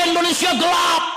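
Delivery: high-pitched rallying cry; student protest shout. Acted. Students protest to the government, high rallying shout. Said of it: "Mahasiswa teriak".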